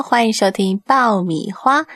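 ordinary talk, nothing marked

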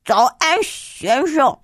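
lightly voiced quavery old-man greeting; trembling old man. Child disguised as an old man greeting Dad, lightly trembling old-man voice. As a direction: put on a shaky old man's voice. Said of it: "我用老男人颤抖的声音说".

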